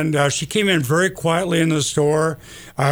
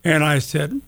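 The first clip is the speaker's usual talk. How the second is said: ordinary talk, nothing marked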